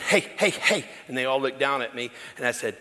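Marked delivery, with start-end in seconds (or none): 0.0-0.8 calling out. Retold or imitated.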